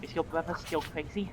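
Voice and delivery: soft, leaning-in whisper; a half-voiced secret aside; a clerk slips a quiet question to Joseph. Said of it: "leans in as he shakes your hand and whispers".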